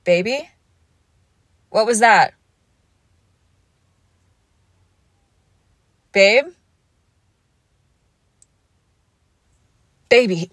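loud anxious calling out; worried calling. Acted. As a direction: call their name, alarmed. Partner collapsed, calling out.

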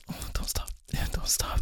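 whispered pleading; breathless whisper. Acted. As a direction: beg it in a whisper. Pleading during sex.

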